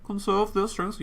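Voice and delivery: high-pitched attempt at a German accent. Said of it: "I went very German there".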